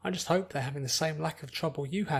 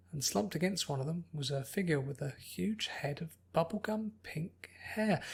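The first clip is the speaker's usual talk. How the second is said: usual speaking voice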